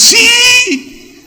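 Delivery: a high, yelled yes; shouted yes. Retold or imitated. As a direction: cry it out together in relief. Disturbed congregation answers together.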